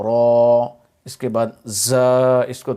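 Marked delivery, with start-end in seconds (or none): 0.0-0.7 را full and heavy, louder. Shown as a demonstration. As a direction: give را a full, thick sound. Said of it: "اس کو پر کر کے پڑھنا ہے".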